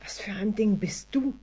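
hoarse whisper; frightened woman croaking at the creature. A narrator's reading. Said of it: "krächzte sie".

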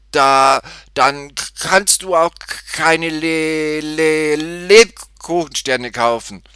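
high, slow, heavily stuttered refusal; loud stammer. A narrator's reading. Cashier refuses the girl without money, stammering loudly. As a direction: push through repeated syllables, loud and high. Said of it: "stotterte er".

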